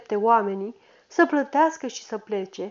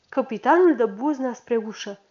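ordinary delivery, nothing marked

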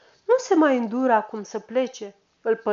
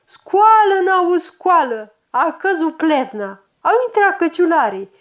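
strigă mult mai tare și mai sus să-l trezească; zgâlțâie un om adormit cu vocea; strigăt; 6 semitones higher, 7 dB louder than usual. Acted. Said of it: "apoi strigă". (strigăt de trezire)

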